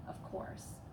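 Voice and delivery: plain reading voice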